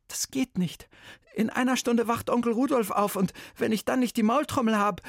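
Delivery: put-on nervous boy blurting out his trouble; boy's voice.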